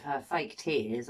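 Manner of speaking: normal talking voice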